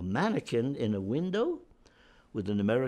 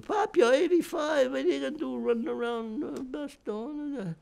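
retold family outcry, much higher; family hollering; 13 semitones higher, 3 dB louder than usual. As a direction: squeal it out like the worried kids protesting. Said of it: "everybody starts hollering".

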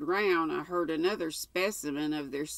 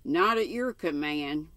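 usual speaking voice

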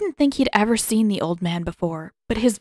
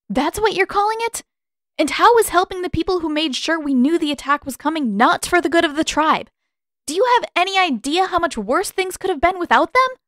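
much higher, louder retort; outraged; 10 semitones higher, 4 dB louder than usual. A narrator's reading. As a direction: throw his words back at him. Katara arguing hotly with Pakku.

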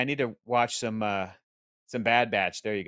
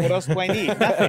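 higher, louder, over the top; mock excited fan; 6 semitones higher, 4 dB louder than usual. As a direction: ham up the superfan. Mock greedy fan voice, loud.

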